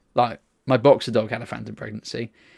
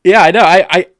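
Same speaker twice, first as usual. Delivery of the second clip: loud; 7 semitones higher, 13 dB louder than usual. Real, not acted. Excited reply about famous follower.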